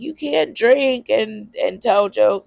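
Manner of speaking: mocking quote. Mocking people who doubt her.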